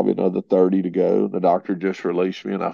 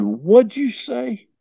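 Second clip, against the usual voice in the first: incredulous; much slower than usual. Retold or imitated. Wife reacting in disbelief.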